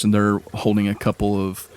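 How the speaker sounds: ordinary talk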